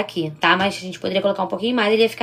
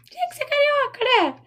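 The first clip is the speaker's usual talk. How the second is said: much higher, playful teasing sigh; mock exasperation. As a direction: tease her like a fond rival. Teasing a viewer from Rio. ("tinha que ser carioca" em tom agudo e brincalhão)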